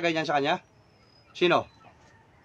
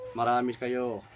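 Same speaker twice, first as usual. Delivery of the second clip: lower, softer, matter-of-fact; low musing echo; 7 semitones lower, 6 dB quieter, much slower than usual. Real, not acted. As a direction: repeat the answer quietly to yourself. Repeats the spirit's answer to himself.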